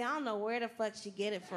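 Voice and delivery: ordinary talk, nothing marked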